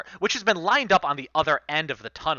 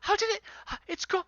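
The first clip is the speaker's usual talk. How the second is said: frantic searching; faster than usual. Acted. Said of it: "He says".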